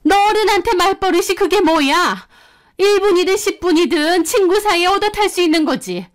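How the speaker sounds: irritated teacher voice, pitched high; scolding teacher. Retold or imitated. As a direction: scold the rude kid from above. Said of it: "미간을 확 찌푸리더군요".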